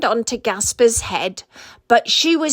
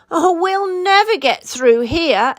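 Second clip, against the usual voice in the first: exclaiming; 6 semitones higher than usual. A narrator's reading. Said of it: "exclaimed Gasper".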